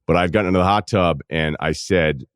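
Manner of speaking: ordinary delivery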